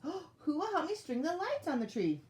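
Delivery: high-pitched, hopeful asking; eager elf request. A narrator's reading. Elf asking friends to help with lights, high and eager.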